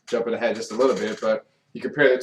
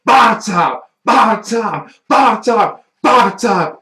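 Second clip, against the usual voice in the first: booming wrestler catchphrase, higher and louder; wrestling promo; 10 semitones higher, 11 dB louder, much slower than usual. Acted. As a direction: shout it like a ring announcer hype. Wrestling intro catchphrase chant.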